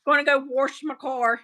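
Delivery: "wash" said as "warsh"; country-style "wash" with an extra R; added R. Shown as a demonstration. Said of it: "Some people do add a R to it".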